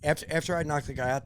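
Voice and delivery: normal talking voice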